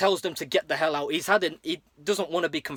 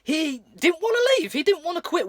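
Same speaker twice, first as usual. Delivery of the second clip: much higher, louder put-on voice; pleading witness; 11 semitones higher, 4 dB louder than usual. Louie on the stand blaming Bart, voiced high. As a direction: protest innocence in a squeaky voice. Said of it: "Louis is on the stand".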